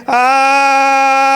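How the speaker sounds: loud, sustained level "aaah"; long mock "aah". Preacher mimics reading the alarming e-mail, long mock "aah". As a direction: hold one long comic cry.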